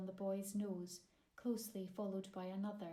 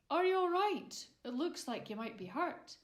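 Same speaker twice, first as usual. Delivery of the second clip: gentle giant; 6 semitones higher, 7 dB louder than usual. A narrator's reading. Giant voice in the story.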